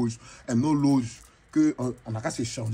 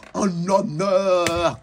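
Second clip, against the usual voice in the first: mock grand announcement; 4 semitones higher, 6 dB louder, much slower than usual.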